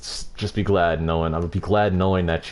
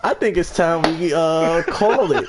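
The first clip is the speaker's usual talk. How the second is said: laughing; much slower than usual. Real, not acted. Laughing wrap-up.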